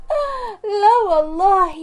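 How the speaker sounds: high, sulky "yeah right" with a pout; mother-in-law pouting sarcastically at the apology; sarcastic pout. Acted. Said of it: "للوت بوزها".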